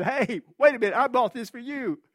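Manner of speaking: high, loud call across the room. Retold or imitated. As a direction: call out to stop the boy. Calling to the boy across auction.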